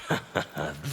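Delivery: low scornful chuckle at the constable; mocking chuckle. Havoc chuckling at the constable's charge. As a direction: laugh off her threat with contempt.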